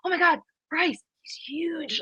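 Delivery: high, hushed mock amazement; imitating awestruck teens. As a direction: gasp it softly like a starstruck high schooler. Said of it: "some high school players that were there".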